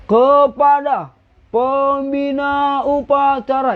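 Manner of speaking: high, slow, drawn-out schoolkid command; anak SD. Shown as a demonstration. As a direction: drag every syllable like a primary school pupil. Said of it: "jangan kayak anak S D gini". (aba-aba melengking dan diseret ala anak SD)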